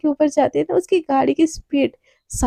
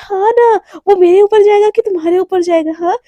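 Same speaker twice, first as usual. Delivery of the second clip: insistent bickering; 5 semitones higher, 5 dB louder than usual. Acted. Insistent partner in acted bickering.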